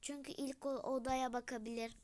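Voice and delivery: ordinary delivery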